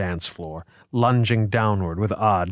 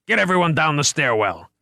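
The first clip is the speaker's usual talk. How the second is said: raised commanding shout; urgent order; 7 semitones higher than usual. A narrator's reading. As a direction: push them to move now. Ordering escape from monster.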